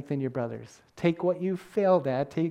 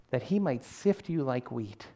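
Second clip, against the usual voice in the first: normal talking voice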